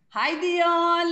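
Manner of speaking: usual speaking voice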